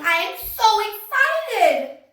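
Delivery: high, loud, slow burst of excitement; excited exclamation. Shown as a demonstration. Teacher to class, demoing an exclamation: high, loud and excited. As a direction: show the exclamation mark with feeling. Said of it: "raise my voice and say it with a lot of feeling".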